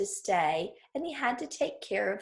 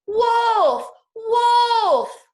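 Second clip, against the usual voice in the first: high, very loud call of Wolf; a boy's full-voiced alarm cry; loudest shout; 13 semitones higher, 9 dB louder than usual. A narrator's reading. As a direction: yell it across the whole meadow. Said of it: "cried out in his loudest voice".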